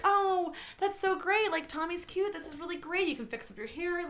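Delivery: high-pitched encouraging gush; super supportive. Retold or imitated. Imitating a teacher encouraging Carrie, super supportive. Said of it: "she's being super supportive".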